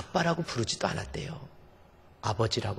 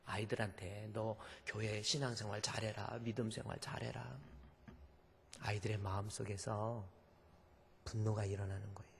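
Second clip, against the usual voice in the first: much softer, near whisper; hushed; 11 dB quieter than usual. Real, not acted. Preacher warns parents, speaking much softer. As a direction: pull the room in close and quiet. Soft sermon passage.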